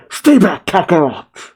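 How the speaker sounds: high, loud warning shout; Vegeta shouting. A narrator's reading. Battered Vegeta warning Goku off, shouting. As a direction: shout a sharp warning to hold someone off. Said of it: "Vegeta shouts".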